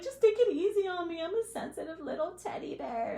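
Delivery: put-on high, pleading baby voice that fades off. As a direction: beg them to go easy, playing fragile. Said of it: "And you're like".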